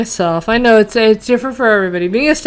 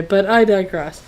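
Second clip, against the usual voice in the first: ordinary delivery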